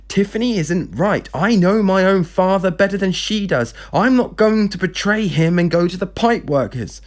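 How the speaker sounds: acted outburst of frustration; wanting to shout. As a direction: insist heatedly, just short of screaming. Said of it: "She wanted to shout and scream at him".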